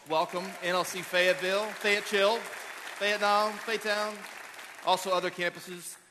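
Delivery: high, loud playful hailing; campus shout-out. Real, not acted. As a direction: hail the far campus with rising energy.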